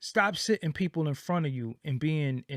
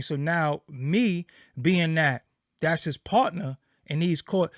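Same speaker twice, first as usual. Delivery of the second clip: ordinary delivery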